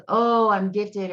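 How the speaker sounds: a mocking boast of being gifted; mock self-important. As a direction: puff yourself up mockingly. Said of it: "I don't like to think of it as".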